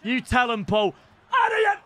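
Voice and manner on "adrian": excited call. Real, not acted. Calling out a name.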